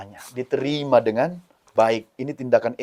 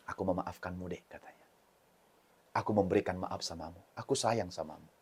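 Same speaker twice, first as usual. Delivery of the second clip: tender forgiveness; 6 semitones lower, 17 dB quieter than usual. Acted. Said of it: "Lalu apa katanya?".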